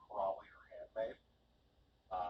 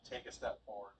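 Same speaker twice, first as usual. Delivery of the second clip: ordinary delivery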